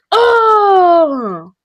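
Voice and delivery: high startled yelp; sudden cry. Real, not acted. Hiccup cry.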